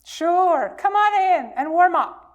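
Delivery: a bright, eager come-on-in from inside the mitten; cheerful welcome. Acted. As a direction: call them in gladly. Animals welcome newcomer.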